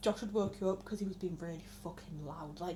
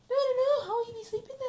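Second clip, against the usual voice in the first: much higher, fussing grandma imitation; grandma's voice; 14 semitones higher, 5 dB louder than usual. Grandma asking about her sleep, high imitation. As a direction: squeak it out like a worried gran. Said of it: "And she went".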